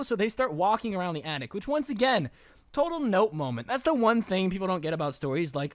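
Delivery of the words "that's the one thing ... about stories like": ordinary talk, nothing marked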